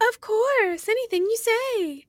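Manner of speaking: high, meek voice of the obedient wife; squeaky yes-dear wife agreeing to anything. Retold or imitated. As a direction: simper it up high, all obedience. Judy voiced meekly obeying husband.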